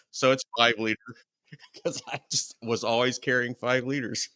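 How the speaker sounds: real chuckles between the phrases; laughing. Real, not acted. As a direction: chuckle through your own punchline. Laughs at own story's punchline.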